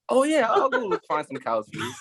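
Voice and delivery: high-pitched, eager retold reply; eager agreement.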